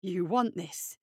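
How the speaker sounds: low-pitched menacing question; low voice, between worry and relish; concern and dark excitement. A narrator's reading. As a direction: ask with a dangerous, held-back thrill. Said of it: "somewhere between concern and a terrible excitement".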